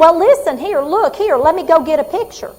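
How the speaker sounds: high, loud, eager burst of recognition; excited recognition. Acted. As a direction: play the patient suddenly recognising her.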